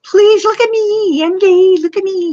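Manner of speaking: mock needy attention-seeker, pitched high; mocking whiny troll. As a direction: whine for attention like a needy kid.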